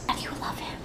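whispered question to a little one; soft, voiceless, conspiratorial asking; hushed aside. Real, not acted. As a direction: drop right down to a whisper. Mock-jealous aside to the child.

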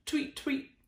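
very high chirping tweets; birdsong. Acted. The dawn birds singing, very high chirps.

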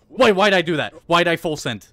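loud, high cry of alarm at his own jump; panicked shout. Real, not acted. Gamer watching his jump go wrong, shouting in panic. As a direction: yell it as the jump goes wrong. Sudden fall in the game.

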